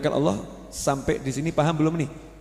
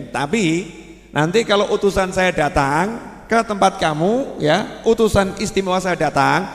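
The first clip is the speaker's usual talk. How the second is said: rising to a louder, higher preaching voice; preaching build; 6 semitones higher, 5 dB louder than usual. Acted. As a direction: drive the ultimatum home to the congregation. Sermon builds to ultimatum.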